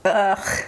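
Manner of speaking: a wet half-laugh through tears, ugh and a breathy laugh; tearful laugh. Real, not acted. Vlogger catching herself mid-tears, a teary little laugh. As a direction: laugh it off while crying. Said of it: "I just get so emotional".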